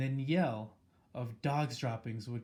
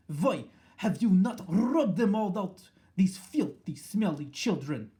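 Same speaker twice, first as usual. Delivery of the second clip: higher, louder, foreign-sounding witch ranting; Grand High Witch screaming; 6 semitones higher, 6 dB louder than usual. A narrator's reading. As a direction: rage at the crowd like a tyrant. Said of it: "With each word she spoke".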